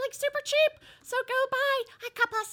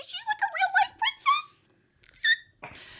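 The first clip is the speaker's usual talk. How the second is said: high, slow princess voice; cartoon princess; 13 semitones higher, slower than usual. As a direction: coo it like a fairy tale princess. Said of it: "we're doing princess voices now".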